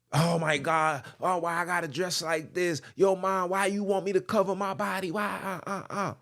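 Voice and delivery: half-hearted impression of a complaining girl; lightly voiced daughter protesting her clothes; whiny daughter. Mocking daughter complaining to mom.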